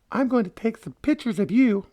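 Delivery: very high falsetto female voice; high-pitched imitation of a woman; put-on woman's voice. Woman hiker's line, voiced high.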